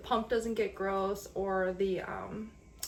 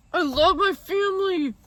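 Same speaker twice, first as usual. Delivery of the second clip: tearful; 8 semitones higher, 10 dB louder than usual. Real, not acted. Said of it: "These are tears of joy".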